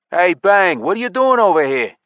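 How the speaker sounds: loud, high-pitched hail; calling out. A narrator's reading. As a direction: call across a busy hall to someone. Elf hails a player across hall.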